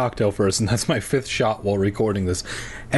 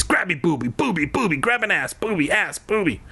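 high, silly chanting; hammed-up juvenile glee, pitch climbing as it goes; mock gleeful boy; 7 semitones higher than usual. Reader imagines a twelve-year-old with this power, mock gleeful chant. Mock boyish riff on grabbing.

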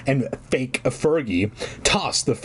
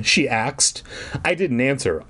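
ordinary talk, nothing marked

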